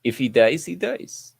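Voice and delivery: a half-hearted Russian movie villain; Russian accent. Pretend. Host attempts the line a listener requested, mock Russian accent. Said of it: "say in a Russian accent".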